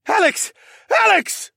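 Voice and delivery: high, loud, urgent bark of a name; barked call. A narrator's reading. Comrade calls the hero off his victim, barking. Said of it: "someone barked".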